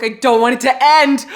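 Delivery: loud, overwhelmed plea; dramatic reader's lament; distraught fangirl. Real, not acted. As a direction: wail it loudly in protest. Overwhelmed by book nearly ending.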